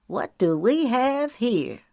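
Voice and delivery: slow, sly menace; leering villain. A narrator's reading. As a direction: savour the moment. Otis leering.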